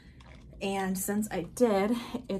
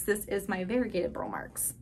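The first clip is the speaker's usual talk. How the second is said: ordinary talk, nothing marked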